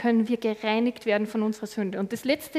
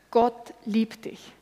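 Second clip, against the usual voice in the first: slow emphasis; much slower than usual. Shown as a demonstration. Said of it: "Kurzform vom Evangelium".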